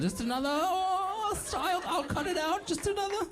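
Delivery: high, teasing mock accent; Zelensky as stripper. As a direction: coax coyly in a high put-on voice. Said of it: "like the highest end stripper".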